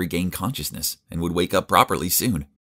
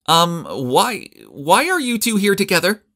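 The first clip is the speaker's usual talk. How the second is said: startled, much higher and louder; surprised; 13 semitones higher, 5 dB louder, slower than usual. A narrator's reading. Elliot startled when they walk in, much higher. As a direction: caught off guard, voice jumping up. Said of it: "almost fell off her chair in surprise".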